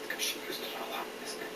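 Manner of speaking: hushed, breathy aside to the little listener; whispered aside. Real, not acted.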